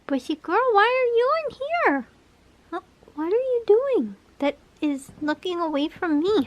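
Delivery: high, loud pet-talk voice. Real, not acted. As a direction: coo at the bird in a high voice. Talking to pet bird.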